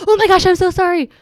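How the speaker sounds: high, loud startled apology; apologetic squeal. Retold or imitated. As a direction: blurt out a sorry in shock. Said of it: "I even was like".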